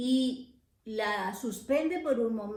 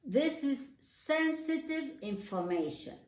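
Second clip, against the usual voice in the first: English pronunciation; 4 semitones higher than usual. Shown as a demonstration. Teacher quoting the English sentence in English pronunciation. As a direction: read in English pronunciation, a shade higher. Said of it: "otro ejemplo que es sensitive".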